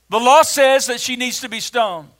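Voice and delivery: loud, high-pitched legal accusation; accusers' loud demand. Acted. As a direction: declaim it forcefully like an accusing crowd. Acting the accusers confronting Jesus.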